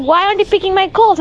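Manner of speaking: high, fast mocking mom voice; quick, high mock of mom's complaint; mom nagging. Mom quoted again, scolding.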